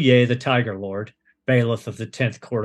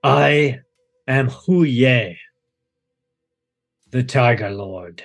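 Tiger Lord voice. Acted. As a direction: announce yourself like a tiger god. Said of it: "and he goes".

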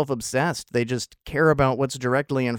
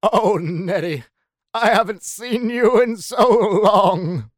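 high, slow, trilling society lady; mock old Hollywood grande dame; Katharine Hepburn impersonation; 8 semitones higher, 9 dB louder, slower than usual. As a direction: stretch every word out, high and grand. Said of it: "my Katharine Hepburn impersonation".

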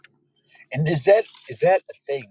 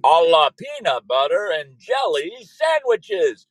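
hammed-up Transylvanian announcer; Count Dracula; 7 semitones higher, 6 dB louder than usual. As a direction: count it out like a cartoon vampire, laughing ah-ah-ah. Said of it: "I feel like Count Dracula".

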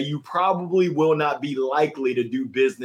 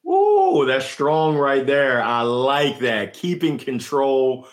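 ordinary delivery

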